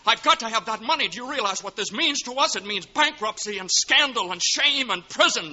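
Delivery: loud, high, furious tirade; furious berating. Acted. George raging at Uncle Billy over the lost money. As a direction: tear into him, desperate and raging.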